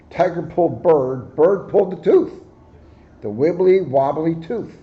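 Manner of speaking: ordinary delivery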